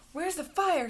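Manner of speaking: shouting fireman, read aloud; fireman's shout. A narrator's reading. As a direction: call it out high and urgent. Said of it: "shouts the fireman".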